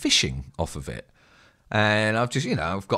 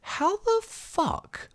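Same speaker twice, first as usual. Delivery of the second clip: much higher, slow, baffled; incredulous thought; 6 dB quieter, much slower than usual. Retold or imitated. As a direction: stretch it out in disbelief. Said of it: "always thought to myself".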